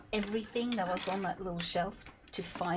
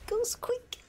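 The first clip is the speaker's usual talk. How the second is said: "Goes quick" much higher, rising; high sing-song repeat. Shown as a demonstration.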